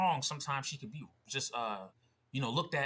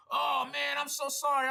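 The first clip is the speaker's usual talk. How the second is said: mock apology, high and louder; over-apologetic; 13 semitones higher, 5 dB louder than usual. Stranger apologizes once corrected, imitated over-apologetic voice. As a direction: imitate a gushing backtrack. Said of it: "He's like".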